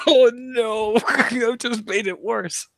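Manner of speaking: high, loud laughing protest. Real, not acted. Player groaning to game master as it worsens, laughing. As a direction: laugh through the words, pitch shooting up.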